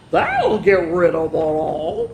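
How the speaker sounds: slow, lightly voiced pig; a touch of a lazy pig. Pig giving silly advice to hedgehog, slow drawl. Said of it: "snorted Pig".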